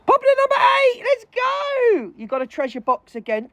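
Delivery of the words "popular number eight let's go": hype call. Real, not acted. Hyping the live stream.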